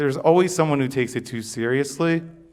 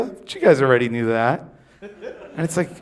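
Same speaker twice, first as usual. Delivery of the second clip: ordinary talk, nothing marked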